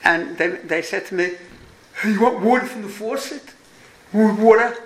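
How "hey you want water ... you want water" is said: kids mocking a New York accent; lightly imitated New York "water". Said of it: "they made fun of my New York accent".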